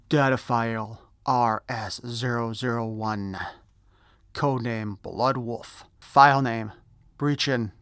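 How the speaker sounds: slow, dramatic file readout; announcer voice. Acted. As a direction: read it like a dossier. Reads file card in announcer voice.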